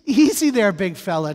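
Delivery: loud, high mock alarm reining in James; playful whoa. [name] teasing James, loud playful alarm. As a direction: pull back a runaway speaker like a horse.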